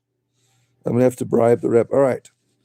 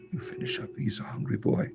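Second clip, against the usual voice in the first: soft unvoiced whisper; ASMR whisper; 3 semitones lower, 12 dB quieter than usual. Shown as a demonstration. Vlogger whispering over the rabbit eating, ASMR style. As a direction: breathe the words out, no voice at all. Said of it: "This is rabbit A S M R here".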